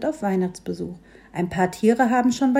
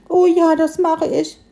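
piglet grunt; 7 semitones higher, 5 dB louder than usual. A narrator's reading. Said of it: "grunzte das Schweinchen".

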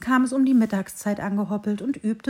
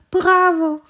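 second bravo even higher, loud and thrilled; cheering bravo; 9 semitones higher, 8 dB louder than usual. Acted. Tree cheering its friend's salto again, high loud cheer. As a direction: keep cheering, louder still. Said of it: "rief das Bäumchen".

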